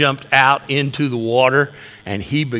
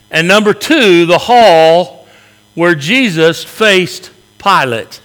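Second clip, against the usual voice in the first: loud, higher fiery preaching voice; preaching at full force; 7 semitones higher, 10 dB louder than usual. Acted. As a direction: preach it out to the whole church.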